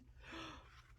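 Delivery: high breathy gasp of surprise; startled gasp of effort; sharp gasp. Acted. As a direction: gasp as he breaks free. Edwin bursts from shell.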